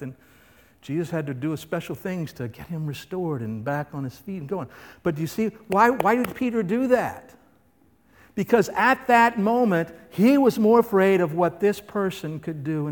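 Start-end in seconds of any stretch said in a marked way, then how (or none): none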